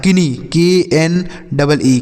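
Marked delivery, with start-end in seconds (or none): 0.0-0.3 किनी with the K sounded; wrong reading. Shown as a demonstration. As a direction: misread किनी in two syllables, "ki-nee". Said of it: "इसे आपको इस तरीके से नहीं पढ़ना है".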